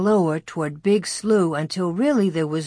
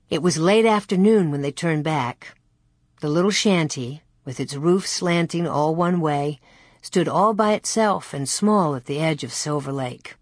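ordinary delivery, nothing marked

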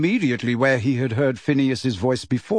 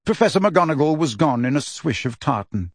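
usual speaking voice

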